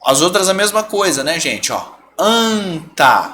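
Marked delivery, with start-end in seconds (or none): 2.2-3.3 ANTA with a long nasal first A; slow ANTA, nasal AN then TA; nasal vowel. Shown as a demonstration. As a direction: stretch ANTA slowly through the nose. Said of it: "Se você tira o N".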